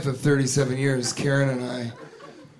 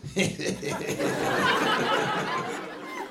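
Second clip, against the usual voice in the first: high, breathy imitation of his creepy giggle; imitating a creepy laugh; 6 dB quieter than usual. Comic mimics an audience member's laugh like a peeping tom. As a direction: mimic the guy's leering laugh. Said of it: "It's like a peeping Tom".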